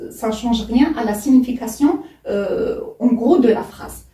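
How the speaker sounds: ordinary talk, nothing marked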